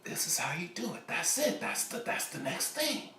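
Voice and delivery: high breathy whispered discovery; excited whisper. Acted. Singer whispers the new hook he just discovered. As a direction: whisper it like a sudden idea.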